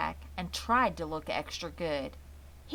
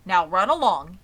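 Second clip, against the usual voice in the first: put-on louder, a father shooing the kids off; father's firm send-off; 11 dB louder than usual. A narrator's reading. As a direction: send them off with a loud, firm word. Father sends children off.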